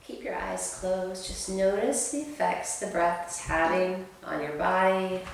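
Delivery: soft, slow yoga guidance voice; calm guided instruction. Acted. Yoga teacher guiding class through calm breathing. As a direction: ease them through the breath gently.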